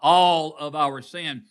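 "All of our sin." slow and loud, each word hammered; slow loud emphasis. Real, not acted. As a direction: pastor insisting the church answer with conviction, slow and loud. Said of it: "I don't think y'all are serious".

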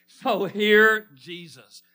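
loud, drawn-out slogan; big pulpit proclamation; emphatic preaching. Real, not acted. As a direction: stretch the call out with force. Restates sermon title to congregation.

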